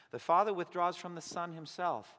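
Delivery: usual speaking voice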